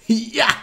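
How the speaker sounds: high, loud martial-arts kiai; kick shout "hi-yah". Acted. [name] kicks the for sale sign, loud kick shout. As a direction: yell it like a karate kick.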